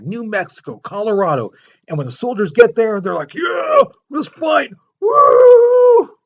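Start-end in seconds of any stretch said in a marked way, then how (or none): 3.3-6.2 very high, loud slow whooping war cry; eager battle cry. Retold or imitated. American soldiers arriving to fight, rowdy whooping battle cry.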